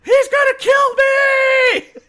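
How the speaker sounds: high, loud scream of mock terror. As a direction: scream for help like a panicked little sister, drawn out. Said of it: "screaming into the house".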